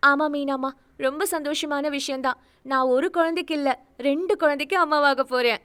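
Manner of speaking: bursting with the news of twins; happy. Acted. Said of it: "சந்தோஷமாக கூறினாள்". (உயர்ந்த குரலில் மகிழ்ச்சி)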